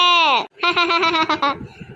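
long ringing laugh, ha-ha-ha; hearty squealing laughter. Acted. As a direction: burst out laughing while being lifted. Loud laugh as husband lifts her.

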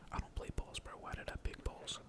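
ordinary talk, nothing marked

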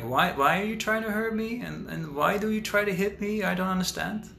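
mock bewildered plea. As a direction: play the hurt innocent who can't understand it. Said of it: "I'm like".

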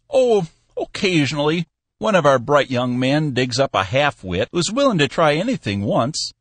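put-on high, dry, joking old man; an old boss with a wink; wry old Cappy. A narrator's reading. Cappy joking to Bill about the spruce, high old man.

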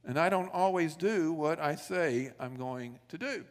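usual speaking voice

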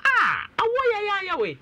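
high-pitched cry of disbelief; dismayed exclamation. Real, not acted. Host exclaims at guest's story.

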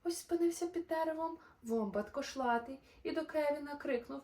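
lively, sing-song storybook narration; animated bedtime-story voice; reading to children. A narrator's reading. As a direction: read the rhymes brightly to little listeners. Reads a rhyming children's story.

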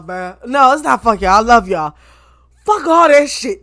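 ordinary talk

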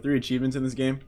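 ordinary talk, nothing marked

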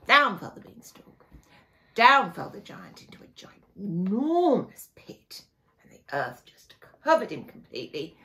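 usual speaking voice

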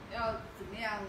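ordinary talk

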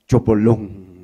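slow spoken splash, camba style; camba splash sound. Acted. Splash of the angel diving, said the camba way. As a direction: plop it like a camba dive. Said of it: "sonido incidental de zambullida a lo camba".

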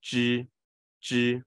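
吱 held long, tongue lifted near the hard palate; drawn-out retroflex 吱. Shown as a demonstration. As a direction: model 吱 slowly and evenly. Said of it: "就在那边稍微翘起来".

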